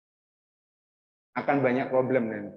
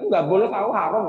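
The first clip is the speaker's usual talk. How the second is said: higher, louder snap of refusal; mock scolding mother; 5 semitones higher, 5 dB louder, faster than usual. Mother forbids child asking about Blackpink, mock scolding. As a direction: act out a mother's sharp ban.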